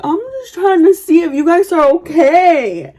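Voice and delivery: re-enacted indignant call; retold yelling back. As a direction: holler a defensive explanation to the neighbours.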